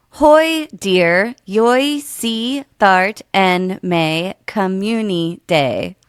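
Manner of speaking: slow, each word on its own; separated, deliberate phonetic reading. Shown as a demonstration. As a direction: read the odd spellings one at a time. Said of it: "if you do each word individually".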